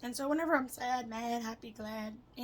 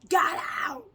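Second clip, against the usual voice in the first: high, very loud trapped cry; acted panicked outburst; frantic shout; 7 semitones higher, 12 dB louder than usual. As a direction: yell it desperately, acted. Acts out being trapped in head.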